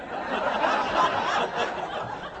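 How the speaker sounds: a voiceless, airy high laugh; breathless laugh. Real, not acted. Preacher laughing at his own prayer, breathless laugh. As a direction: laugh with no voice at all.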